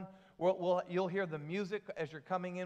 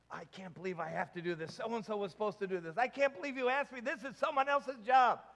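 grumbling complaint. Acted. Preacher griping about others' jobs at home, mounting complaint. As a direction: act out your own griping, rising in pitch and volume. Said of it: "I complain about having to do other people's jobs".

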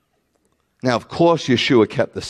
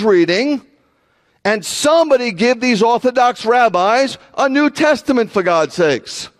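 high, exasperated preaching; full-force preaching; 12 semitones higher, 4 dB louder than usual. Real, not acted. Preacher challenging pastors and rabbis to read Scripture, exasperated full-force preaching. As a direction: hammer it home, pitched high and insistent.

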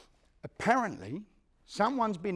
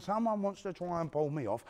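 usual speaking voice

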